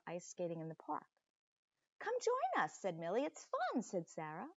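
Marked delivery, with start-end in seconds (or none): 2.0-2.8 put-on bright girlish call, much higher; high friendly call. Millie calls from the ice. 3.2-3.8 put-on girlish voice, much higher. Sarah calls from the ice.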